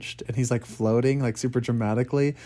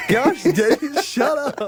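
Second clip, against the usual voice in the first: high, louder, hammed-up yell; exasperated yell; 12 semitones higher, 6 dB louder, slower than usual. Quoting the gangster to friends, a hammed-up exasperated yell. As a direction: snap it out like a fed-up gangster. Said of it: "he's like".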